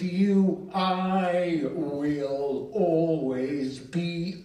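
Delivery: very slow, stretched-out incantation; poem recital. Acted. Poet repeating the refrain to Bernard, chanted slowly. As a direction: draw every word out long, like a solemn chant.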